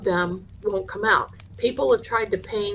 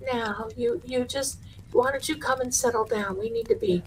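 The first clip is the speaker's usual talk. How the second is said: soothing high voice; 6 semitones higher, 5 dB quieter than usual. Retold or imitated. Mom soothing possessed son.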